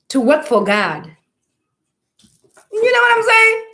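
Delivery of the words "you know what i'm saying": preaching shout. Real, not acted. Preacher rouses his audience.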